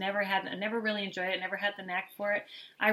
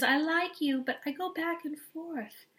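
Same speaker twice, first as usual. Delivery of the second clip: lightly high whiny mock voice; a hint of a whiny girl; whiny insincere; 8 semitones higher than usual. Mother mocking a whiny contestant. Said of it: "She's so whiny and insincere".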